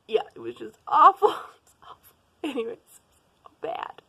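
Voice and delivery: half laughing, half whining about the movie; laughing groan. Real, not acted. Laughing to viewers about the awful movie, real giggly groan. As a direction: laugh through the complaint.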